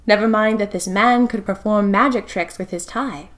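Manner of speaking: usual speaking voice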